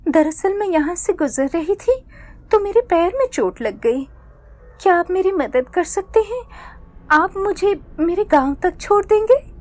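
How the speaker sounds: put-on high, sweet pleading girl voice. Disguised witch begging the boy for a ride, high and pleading. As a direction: coax him with helpless sweetness.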